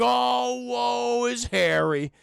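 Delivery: slow, drawn-out mock self-pity; mocking whine. Mocking Harry for whining about Peter, slow mock lament. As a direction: ham up the poor-me act. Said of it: "It's like".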